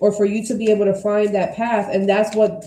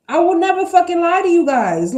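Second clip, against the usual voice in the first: higher and louder, insistent; emphatic; 8 semitones higher, 5 dB louder than usual. Real, not acted. Reader swearing honesty to her viewers, loud and insistent. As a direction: promise it hard.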